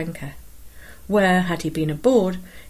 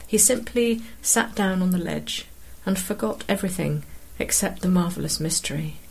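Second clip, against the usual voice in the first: ordinary delivery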